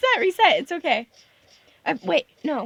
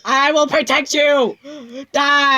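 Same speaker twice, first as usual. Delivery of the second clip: deep loud heroic shout; mock hero battle cry; 7 semitones lower, 4 dB louder than usual. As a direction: roar it like a movie hero.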